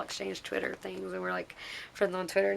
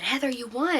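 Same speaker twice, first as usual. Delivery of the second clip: raised, eager news-breaking delivery; retold excited announcement; 5 semitones higher, 4 dB louder than usual. Friend telling Heather she won, excited. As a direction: break the good news to her. Reading excited comment aloud.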